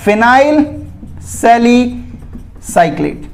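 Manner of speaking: Phenyl सैलिसाइक्लेट drawn out slowly, pause between the words; slow, broken-up naming. Shown as a demonstration. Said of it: "उसे क्या बोलेंगे". (Phenyl सैलिसाइक्लेट धीरे-धीरे, रुक-रुक कर)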